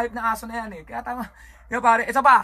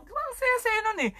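squealed delight; 3 dB quieter than usual. Acted. Squealing over childhood fun.

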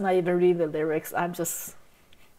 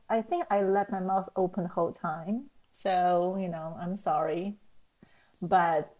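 ordinary delivery

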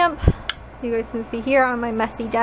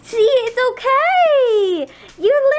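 higher, slow, sing-song praise; high praise for dog; 11 semitones higher, 6 dB louder, much slower than usual. Real, not acted. Owner praising her dog for drinking, in high slow baby talk. Reassuring dog after bubbles.

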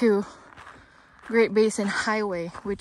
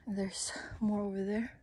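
whispering; 11 dB quieter than usual. Real, not acted. Quiet aside while filming.

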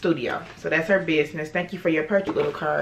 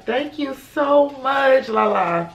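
gushing thanks; 8 semitones higher, 3 dB louder, much slower than usual. Real, not acted. Heartfelt thanks to sender.

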